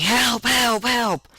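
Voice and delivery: high-pitched cry for help; retold scream for help, high and forceful. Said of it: "I'm inside screaming".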